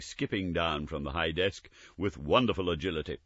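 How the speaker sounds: ordinary delivery, nothing marked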